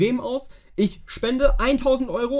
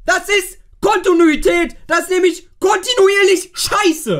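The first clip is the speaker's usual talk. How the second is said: escalating comedy rant; 8 semitones higher, slower than usual. Real, not acted. Showing the bad results.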